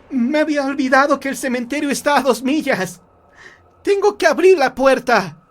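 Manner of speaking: high, loud, near-tearful urgency; frantic mother. Acted. Mother rushing to let her son in, frantic. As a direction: cry out in desperate haste. Mother rushes to open the door.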